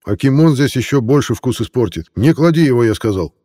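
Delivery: sharp shout at brother. Acted. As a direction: bark the order. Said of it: "Прикрикнул на упёртого брата".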